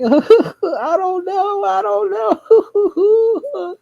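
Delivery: very high, drawn-out Joker-like cackling wail; squealed, stretched imitation of uncontrollable laughing; hysterical laughing. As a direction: act out helpless laughter through the words. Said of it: "laughing up a storm. Literally, I sound like the Joker".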